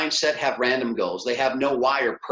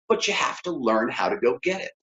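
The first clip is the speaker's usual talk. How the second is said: ordinary delivery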